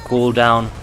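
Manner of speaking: ordinary talk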